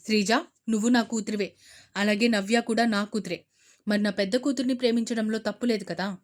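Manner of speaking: loud, fast firm father. Acted. Father arguing with daughter, loud and firm. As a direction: push back hard.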